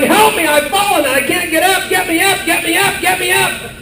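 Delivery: high, insistent cry for help; Grandma's mock yell. Fallen Grandma calling to be lifted, mock yell. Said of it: "Here's Grandma yelling".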